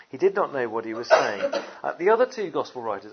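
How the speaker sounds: usual speaking voice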